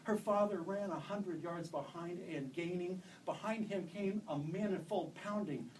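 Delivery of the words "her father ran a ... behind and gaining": ordinary delivery, nothing marked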